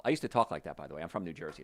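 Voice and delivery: usual speaking voice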